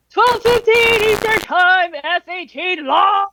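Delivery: high, loud, slow frat bro shout. Pretend. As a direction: yell it like a hyped frat bro. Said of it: "I'm imagining frat bro".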